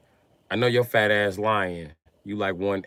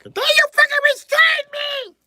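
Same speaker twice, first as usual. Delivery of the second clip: shrill, much higher and louder mock shout; mock angry woman; 10 dB louder than usual. Mocking a short woman, furious shout. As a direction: scream it like an outraged short woman. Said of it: "She be mad a year later".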